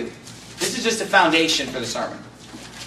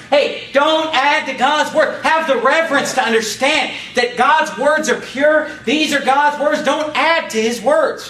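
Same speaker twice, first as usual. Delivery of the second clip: high, raised, insistent pulpit cry; full-force preaching; 8 semitones higher, 4 dB louder than usual. Real, not acted. Preacher warning those who make up God's words, urgent exhortation. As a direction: drive each command home to the congregation.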